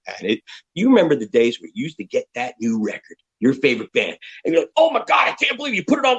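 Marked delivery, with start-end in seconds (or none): 4.8-6.2 high-pitched gush of excitement; mock fan rapture; excited fan. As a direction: squeal like a thrilled fan hearing the record. Fan hearing a new record.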